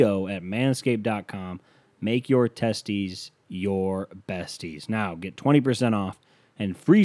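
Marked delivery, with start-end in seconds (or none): 2.0-4.9 very slow punchline slogan, spaced out; mock solemn ad tagline. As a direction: savour each word of the tagline. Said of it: "slogan delivery".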